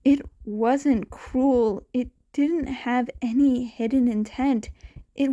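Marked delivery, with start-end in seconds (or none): none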